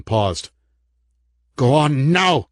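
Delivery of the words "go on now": higher, louder ringing order; powerful command. Acted. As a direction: let the voice vibrate with power. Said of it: "her voice vibrating with power".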